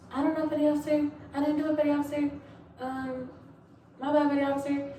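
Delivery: high, loud, fast panicked apology; flustered recruit. Retold or imitated. Recruit apologizing to the officer, high panicked pleading. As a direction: blurt excuses like a scared recruit. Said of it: "I'm like".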